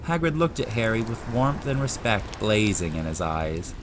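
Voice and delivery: usual speaking voice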